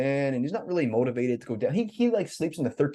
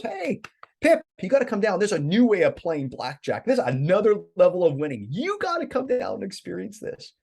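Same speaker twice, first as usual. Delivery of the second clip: excited friend on phone; 6 semitones higher than usual. Retold or imitated.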